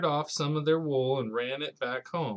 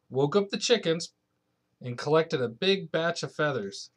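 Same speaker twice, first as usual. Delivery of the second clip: usual speaking voice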